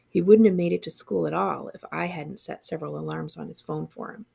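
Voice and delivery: ordinary delivery, nothing marked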